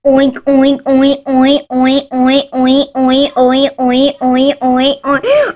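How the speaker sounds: repeated piggy oinking; pig oinks. Acted. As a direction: oink over and over like a pig. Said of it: "tired of hearing these stupid noises".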